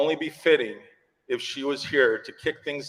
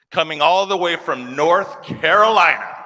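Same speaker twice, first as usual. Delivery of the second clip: arena announcer; 5 semitones higher, 6 dB louder than usual. Acted. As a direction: announce her like a starting lineup. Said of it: "it sounds like the Bulls' introductions".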